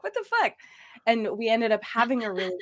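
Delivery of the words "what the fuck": much higher, startled disbelief; shocked outburst. Retold or imitated. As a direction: jolt up in sudden dismay. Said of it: "I was like".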